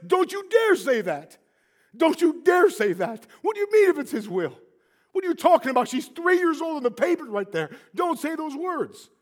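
high, loud, indignant shouting; retold outraged rebuke. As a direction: act out a furious mother scolding. Mother rebukes the praying woman.

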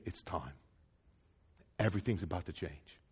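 very low, soft murmur; acted near-whisper, low and calm; hushed revelation. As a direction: quietly announce something momentous. Angels' hushed words to Mary.

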